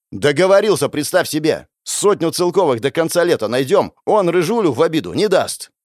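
high, defiant comeback; heated retort. A narrator's reading. Elder answers the taunt about negotiating, heated. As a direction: throw it back at him.

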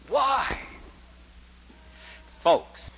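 ordinary talk

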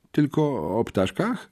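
timid, faltering query, rising at the end; stammered question. A narrator's reading. Syfon echoes his captor's words back, stammering. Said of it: "wyjąkał Syfon".

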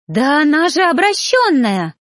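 indignant cry of disgust; choking with indignation. A narrator's reading. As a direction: spit it out, choking on outrage. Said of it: "захлёбывалась негодованием Сария".

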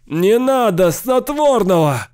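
very high, loud, slow shriek; drawn-out piercing cry; piercing scream. A narrator's reading. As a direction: shriek against the sleeping drug. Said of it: "пронзительно кричал Сейл".

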